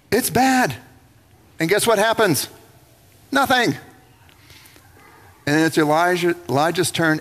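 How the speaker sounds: ordinary talk, nothing marked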